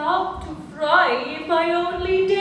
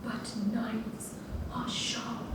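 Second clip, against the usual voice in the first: frightened whisper; 7 semitones lower, 14 dB quieter than usual. Acted. Cook frightened of knives.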